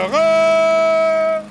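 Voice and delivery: loud, high triumphant tada; triumphant shout. Acted. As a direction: announce it like a fanfare. Fahrt beginnt, Ausruf. (laut und hoch, triumphierend)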